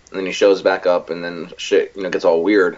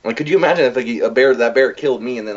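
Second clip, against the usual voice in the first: ordinary talk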